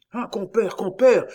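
high, loud cry to a companion; exclaiming. A narrator's reading. Miton calling his companion to look, exclaiming. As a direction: call out in alarm, pitched high. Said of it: "s'écria Miton".

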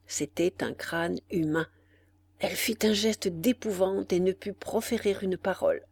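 usual speaking voice

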